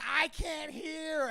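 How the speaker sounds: high, drawn-out heckler yell; mock screaming heckler. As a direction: imitate a drunk heckler wailing out slowly. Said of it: "screaming at, looking at him saying".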